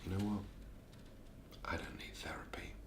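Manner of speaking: breathy whisper to herself. Acted. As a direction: whisper it, almost private. Quiet defiance during therapy.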